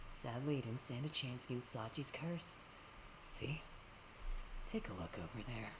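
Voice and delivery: low, soft, sinister villain. Acted. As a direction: taunt them quietly. Voicing villain in game.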